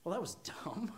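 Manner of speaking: hammed-up God writing you off; mock dismissive God. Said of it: "You think God's like".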